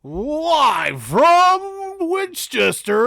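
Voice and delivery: loud, high, slow drawn-out announcer call; ring announcer voice. Shown as a demonstration. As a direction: boom it out like a wrestling ring announcer. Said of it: "I'm gonna do a ring announcer voice".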